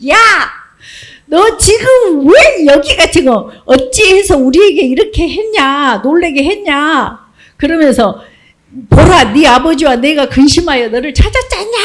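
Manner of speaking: scolding mother. Acted. Frightened mother scolding her missing son, loud and sharp. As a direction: tell off a runaway son. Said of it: "엄마는 지금 놀래가지고 오니까".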